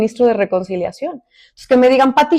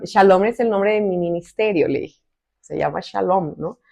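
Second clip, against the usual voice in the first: ordinary talk, nothing marked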